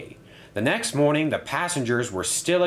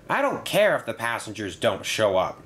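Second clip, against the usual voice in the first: ordinary delivery, nothing marked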